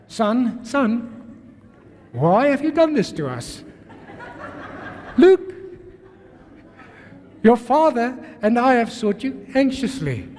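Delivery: sweetly polite but tense; polite mother. Retold or imitated. Mary politely scolding Jesus before the scholars, sweet tone. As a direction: keep up appearances, politely. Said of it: "she very politely says to Jesus".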